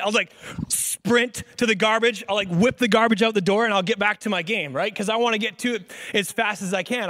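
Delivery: normal talking voice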